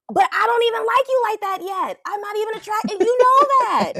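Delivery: imitated incredulous pushback; acted protest. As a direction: voice her protest way up high. Voicing the woman's protest to him.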